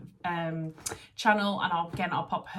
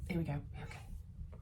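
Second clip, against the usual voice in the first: whisper; 9 dB quieter than usual. Real, not acted. Hears the kids coming.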